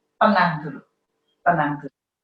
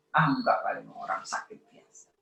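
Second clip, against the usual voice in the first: whispered self-reassurance; 4 semitones lower, 7 dB quieter than usual. Retold or imitated. Calming oneself.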